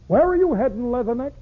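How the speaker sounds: high-pitched hail to a Marine; hailing question. Acted. Someone hails a departing Marine, high calling question. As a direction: call out the question high, in verse.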